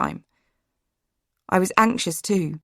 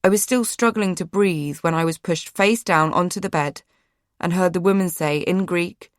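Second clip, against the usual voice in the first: ordinary delivery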